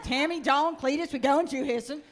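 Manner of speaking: lightly voiced country dad rounding up the kids; mock hillbilly dad calling his family out.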